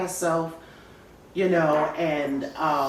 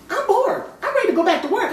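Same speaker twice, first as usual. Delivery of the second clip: much higher, louder whiny mock voice; mimicking bored girlfriends in a high, louder whine; whiny bored friends; 14 semitones higher, 8 dB louder, faster than usual. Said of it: "My girlfriends were calling me".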